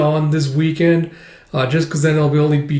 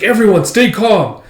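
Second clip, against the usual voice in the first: higher, louder command to the troops; officer shouting to calm panicking soldiers; calling out orders; 7 semitones higher, 5 dB louder than usual. Retold or imitated. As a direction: call over the chaos to settle the men. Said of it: "It's like".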